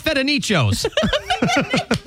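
slow, high, laughing whine. Pretend. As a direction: draw it out through laughter. Laughing mock caller.

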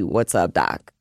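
low, lightly voiced cartoon rabbit greeting; Bugs Bunny greeting. Shown as a demonstration. Host suggesting a new greeting, low cartoon voice. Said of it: "your greeting should actually be".

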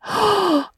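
sharp horrified intake of breath; shocked gasp. A narrator's reading. Marilla smells the bottle, shocked gasp. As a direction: gasp in sudden realization.

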